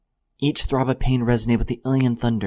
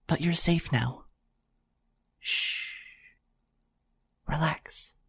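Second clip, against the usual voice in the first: calm a frightened stranger gently; soothing hush; 5 semitones higher than usual. A narrator's reading. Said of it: "murmuring breathy whispers".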